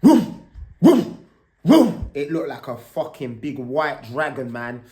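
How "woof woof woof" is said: short sharp barks; imitated dog bark. Boxer dog barking at him through the window. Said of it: "it was just like this".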